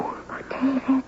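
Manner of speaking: a slow, hushed whisper, barely voiced; whispered tenderly to the man she loves; breathless whisper. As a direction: breathe his name softly, overwhelmed. Tender reply to love confession.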